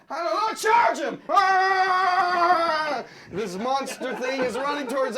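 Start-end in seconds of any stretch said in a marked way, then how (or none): 0.1-1.2 mock battle cry. Panicked order to charge. 1.3-3.0 loud, high mock war cry; a hammed-up battle scream; charging yell.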